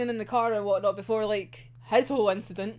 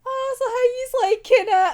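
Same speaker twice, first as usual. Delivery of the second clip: much higher, louder, smug teasing voice; mocking villain; 13 semitones higher, 7 dB louder than usual. Imitating Mori taunting the prisoners, higher and smug. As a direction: taunt the captives sweetly. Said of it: "he's just like".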